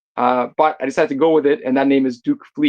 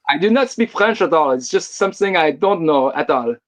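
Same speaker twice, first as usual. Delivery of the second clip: hammed-up French accent; put-on French accent. Guest denying he speaks French, in a horrible French accent. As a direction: lay on a bad French accent. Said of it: "Oh my God, that's horrible accent".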